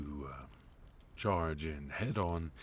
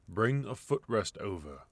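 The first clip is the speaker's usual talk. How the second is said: order to servants; 5 semitones higher, 6 dB louder than usual. Acted.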